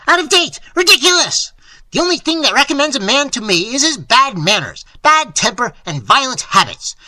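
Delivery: loud, high, irate tirade; furious cantankerous outburst; shouted rant. A narrator's reading. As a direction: rant at him at full volume. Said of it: "he shouted".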